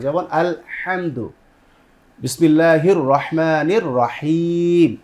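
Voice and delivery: আলহামদু with the হা pressed from the throat, slowly; pressed throat sound. Shown as a demonstration. Teacher demonstrates throat pressing to viewers, slow recitation. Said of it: "এটি কণ্ঠকে চাপিয়ে পড়তে হবে".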